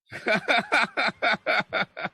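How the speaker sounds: high, rhythmic run of laughter; gleeful laugh. Real, not acted. Speaker gloating to live viewers, high gleeful laughing. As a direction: let out a long string of high ha-has. Laughs after his proclamation.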